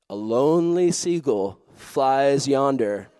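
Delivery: slow, drawn-out storybook grandeur; mock-poetic narration. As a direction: narrate it slowly like an epic tragedy. Mock-poetic climax of his story.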